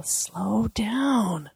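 slow, drawn-out stage whisper; whispered coaching. Retold or imitated. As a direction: whisper the answer to him slowly. More people whisper the answer.

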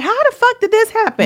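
very high, mock-baffled question; squeaky put-on bewilderment; dumbfounded. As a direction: imitate someone caught totally off guard. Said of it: "stand there dumbfounded".

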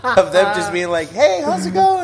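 high, loud laughing words. Real, not acted. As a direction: giggle through the picture. Laughing while imitating the neighbours.

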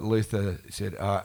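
normal talking voice

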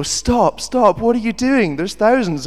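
high, loud protest; mock-panicked shout; imagined alarmed onlookers. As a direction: imitate a crowd calling out to stop someone. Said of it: "people are gonna go".

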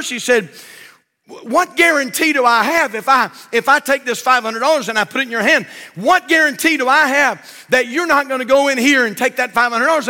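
loud, high preacher telling a joke; hammed-up pulpit storytelling; full-force preaching. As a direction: belt the story out to the church.

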